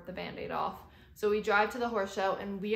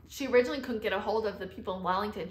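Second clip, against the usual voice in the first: ordinary delivery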